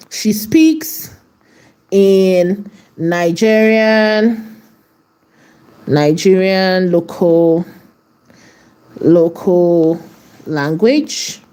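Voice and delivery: drawn out word by word while typing; slow dictation. Shown as a demonstration. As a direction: dictate each word slowly, pausing between. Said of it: "So let's say that".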